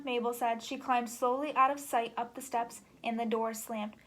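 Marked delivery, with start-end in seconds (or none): none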